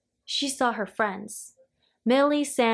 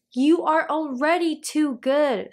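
higher, exasperated narrated cry; mild storybook scream; 5 semitones higher, 3 dB louder than usual. A narrator's reading. Mother Witch scolding Little Witch for goodness, mild scream. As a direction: complain in a contained scream. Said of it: "screamed Mother Witch".

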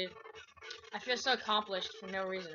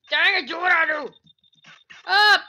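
loud, high angry commands; frustrated yelling; 7 semitones higher, 13 dB louder than usual. Real, not acted. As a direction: yell the orders at the character. Yelling at game character.

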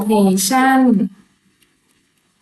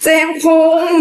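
calling out; 7 semitones higher than usual. Real, not acted. Calls out a friend's name.

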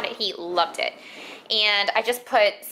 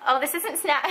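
ordinary delivery

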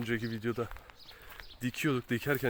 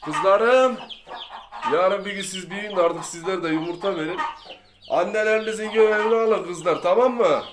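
cooing to chicks; 7 semitones higher, 9 dB louder than usual. Real, not acted. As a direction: gush over the little chicks. Talking sweetly to the chicks.

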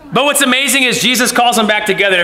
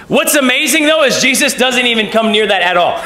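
ordinary talk, nothing marked

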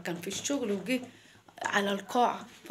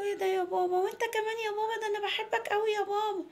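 put-on high child voice appealing to daddy; pleading little girl; 10 semitones higher than usual. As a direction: plead like a small girl to her dad. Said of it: "قالت له". (صوت طفلة بتعاتب باباها)

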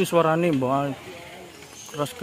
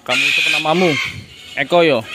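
loud, playful questioning; calling to a bird; 3 semitones higher, 7 dB louder, much faster than usual. Real, not acted. Asking the cockatoo its name, calling out loudly. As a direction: raise your voice to get the bird's attention.

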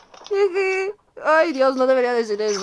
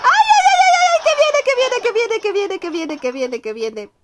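panic fading out; 7 semitones higher than usual. Real, not acted. Panicking as enemy approaches. (chillido de pánico que se va apagando)

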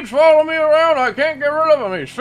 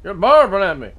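put-on thick rural accent; 4 semitones lower, 4 dB louder than usual. Local insists this is how he always talks, thick rural accent. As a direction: lean hard on the rural accent and the boy.

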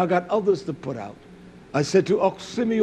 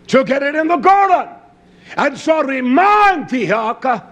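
raised pulpit voice, stretching out key words; emphatic preaching; 5 semitones higher, 5 dB louder than usual. Real, not acted. Preacher telling of labor in the garden, emphatic preaching. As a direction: preach the hard work as a blessing.

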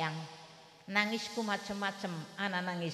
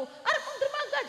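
high tearful wail; crying mother. Retold or imitated. Mother answering while weeping at the dock, high wail. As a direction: wail it out in a cracking voice. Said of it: "Ari wis kaya kononku".

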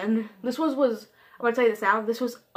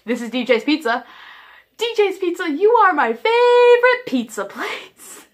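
rising, louder, slow fan shout; over-the-top gushing; 8 semitones higher, 7 dB louder than usual. Retold or imitated. Caller gushing at the pizza place, high and loud. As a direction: gush it like a superfan. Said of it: "do that to the rest of the pizza places".

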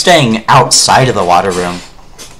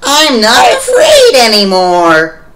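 mock brave; 11 semitones higher, 5 dB louder than usual. Brave declaration entering dark rooms.

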